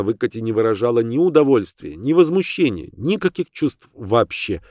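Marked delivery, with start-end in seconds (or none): none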